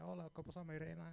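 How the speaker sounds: smooth Spanish greeting to the cashier. Retold or imitated. Greeting the register lady in Spanish, lightly charming. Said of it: "I spoke a little bit Spanish".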